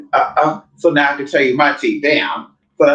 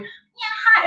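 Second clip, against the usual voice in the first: put-on high girly voice. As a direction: squeak a dainty hi. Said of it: "when she talked regularly".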